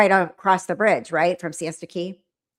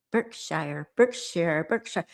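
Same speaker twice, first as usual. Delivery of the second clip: ordinary talk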